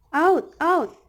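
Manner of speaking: "Out, out" as two high, loud, matching beats; equal stress. Shown as a demonstration. Teacher giving spondaic example, equal loud stress on "Out, out". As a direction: punch each "out" equally hard. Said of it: "all syllables have equal stress".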